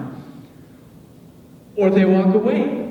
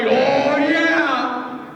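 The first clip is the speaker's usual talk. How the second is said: knowing sing-song; 7 semitones higher than usual. Retold or imitated. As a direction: tease the crowd with a singsong. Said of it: "You all are like".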